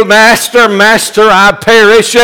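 high, loud plea from the pulpit; desperate cry. Pretend. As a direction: cry out Master like a drowning man. Crying out to the Lord.

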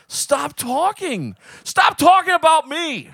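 slow, high, drawn-out plea; begging friends to stop roasting him; mock begging. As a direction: stretch it out, begging. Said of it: "I'm just like".

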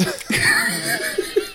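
airy squeal of a laugh; giggle, almost silent and high; cracking up; breathless high laugh. Real, not acted. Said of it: "laughs after the chant".

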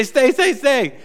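high, firm mock command; dog command. Owner commanding the dog to stay, high and firm. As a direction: order him to stop.

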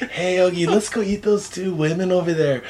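cartoon bear slipping into a Mexican accent; soft sidekick with an accidental Mexican accent; little bear, badly faked, turning Mexican; Boo Boo. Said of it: "did Boo Boo suddenly turn Mexican".

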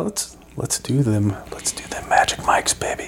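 breathy come-on whisper; sultry whisper. Pretend. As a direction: whisper it like a stripper tease. Sultry Magic Mike bit.

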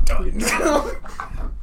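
drawn-out, high, loud fake fury; mock dramatic outburst. Teasing friend Sam on the car ride. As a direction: stretch it slow like a hammed-up meltdown. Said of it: "I'd be like".

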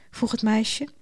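ordinary delivery, nothing marked

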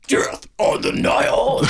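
slow, unvoiced death-metal growl; metal band voice. Shown as a demonstration. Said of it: "Sorry, let me say that again".

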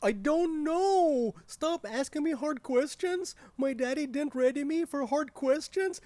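put-on high, stretched-out childish protest; whiny tantrum. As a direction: whine like a coddled kid under pressure. Tantrum when pressed with hard questions.

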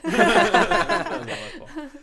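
drawn-out, laughing "That was all my fault"; laughing mock confession. Host repeats the wife's blame-taking, slow and laughing. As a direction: laugh through a fake confession. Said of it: "repeats the quote, laughing".

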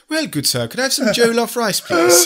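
mock Nigerian accent, rising high and loud. Customer politely ordering jollof rice, mock Nigerian accent. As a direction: put on a comic Nigerian accent, climbing towards the end. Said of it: "You go into a Nigerian accent".

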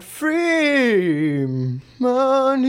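high put-on "Free money"; mock caller voice. Podcaster replaying his prank line to cohost. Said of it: "I went".

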